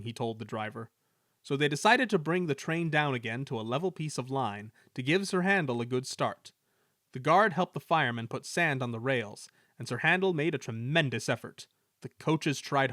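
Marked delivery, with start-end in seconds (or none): none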